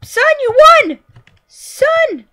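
loud high celebratory call; real excited cheer. Real, not acted. Cheering the son.

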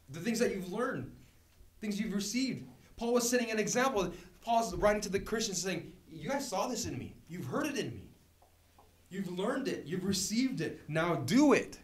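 high, punchy exhortation; forceful preaching. Real, not acted. Preacher urging Christians to do it, forceful preaching. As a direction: drive each phrase home, building to a loud command.

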